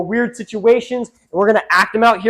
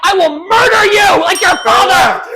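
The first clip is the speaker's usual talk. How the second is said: loud murderous threat; shouted threat; 7 semitones higher, 3 dB louder than usual. Acted. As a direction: yell the threat. Court threat.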